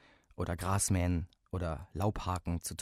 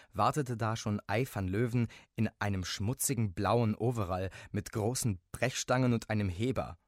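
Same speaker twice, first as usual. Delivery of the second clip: plain reading voice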